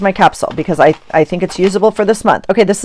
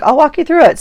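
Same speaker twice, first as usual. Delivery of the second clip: friend offering; 10 semitones higher, faster than usual. Retold or imitated. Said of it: "she's like".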